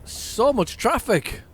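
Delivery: slow, high, drawn-out groan; exasperated. Real, not acted. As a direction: stretch the words out in disbelief at the jam. Groaning at heavy traffic.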